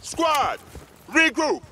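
shouted order. Acted. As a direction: bark the order across the field. Sergeant calls squad together.

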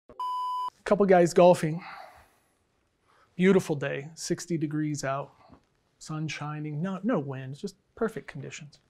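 ordinary delivery

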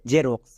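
জেরক্স said slowly as "je-roks" with a j sound; teacher modelling জেরক্স; correct pronunciation. Shown as a demonstration. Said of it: "উচ্চারণ হবে জেরক্স".

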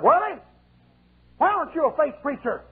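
a demon jeering at the preacher; mocking demon voice. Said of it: "He said".

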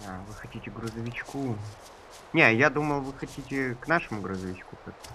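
ordinary talk

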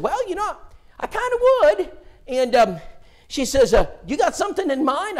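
shy woman coming round; Phyllis's voice. Retold or imitated.

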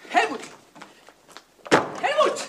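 high, loud call of his name. Acted. Wife calling her husband through a locked door, loud and high.

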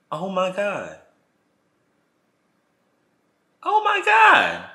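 high, drawn-out shocked gasp of words; stunned disbelief. Retold or imitated. Catching them in the alley, stunned and slow. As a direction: voice leaps up slowly in disbelief. Shocked at what she saw.

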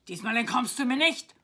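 very loud triumphant battle cry; full-force acted roar; roaring knight. A narrator's reading. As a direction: bellow a threat as a storybook knight. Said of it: "brüllte der weiße Ritter".

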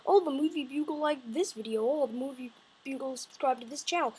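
lightly voiced notification. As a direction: read it like a spam alert. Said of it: "you're gonna get spammed with, like".

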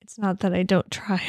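defensive whispered aside; a sheepish whispered excuse. Real, not acted. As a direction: breathe it out under your breath.

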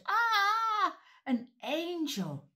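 sudden high loud frightened cry; startled shepherds cry out; frightened shout. Acted. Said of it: "they all started to shout".

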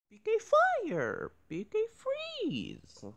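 high, slow attack shouts; high attack calls. Retold or imitated. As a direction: call the move in a high voice.

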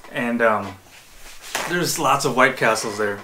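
normal talking voice

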